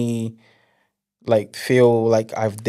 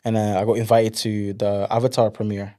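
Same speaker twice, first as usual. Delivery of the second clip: ordinary talk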